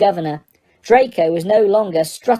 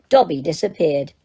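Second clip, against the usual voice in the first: ordinary delivery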